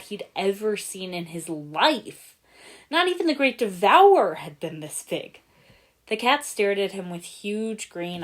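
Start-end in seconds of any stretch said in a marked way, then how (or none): 2.9-5.4 awed. A narrator's reading. Awe at huge cat.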